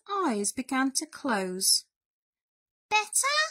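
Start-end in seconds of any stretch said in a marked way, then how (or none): 2.9-3.4 very high little question; high, small storybook mouse; inquiring mouse. A narrator's reading. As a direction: inquire hopefully as a tiny mouse. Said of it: "inquired Mouse".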